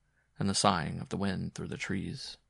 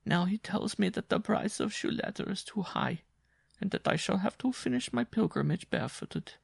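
raised-pitch weary circus strongwoman; a worn-out woman telling her hardship; Jean's voice; 12 semitones higher than usual. Acted. To Simon, recounting the wolf-dog, higher worn voice. Jean continues.

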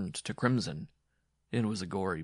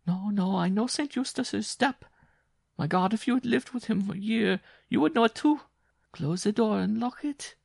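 very high, urgent pleading voice; panicked woman; 6 dB louder than usual. A narrator's reading. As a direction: plead in a high, frightened wail. Terrified woman warns her lover.

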